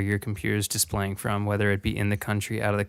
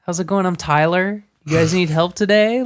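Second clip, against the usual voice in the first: store clerk voice; 8 semitones higher, 4 dB louder than usual. Retold or imitated. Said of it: "he's like".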